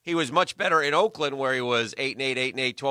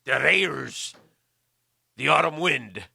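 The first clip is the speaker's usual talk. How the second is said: hammed-up pirate with a drawn-out arr; 2 semitones higher, much slower than usual. Co-host takes up a request to do it like a pirate, hammed-up pirate voice. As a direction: play it as a mock pirate. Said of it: "Do it like a pirate".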